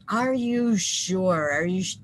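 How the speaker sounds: slow, drawn-out "are you sure?"; hesitant mock questioning; doubtful parent. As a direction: stretch it out like a wary, doubting parent.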